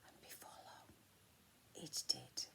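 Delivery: hushed, ominous whisper; dramatic whisper. A narrator's reading. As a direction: drop to a secret whisper for the twist. Ominous story turn.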